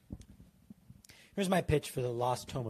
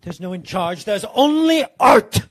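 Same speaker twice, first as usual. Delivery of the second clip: building to a high, loud theatrical cry; mock-grand proclamation; 5 semitones higher, 15 dB louder than usual. Podcaster rejecting any leader in charge, mock-grand shout. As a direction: proclaim it with hammed-up artistic fervour.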